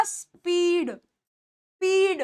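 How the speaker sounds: "स्पीड" with a long, drawn-out ee; long ee sound. Shown as a demonstration. Teacher models स्पीड for students, long vowel. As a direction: stretch स्पीड slowly on the ee. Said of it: "बड़ी ई की मात्रा हो जाती है".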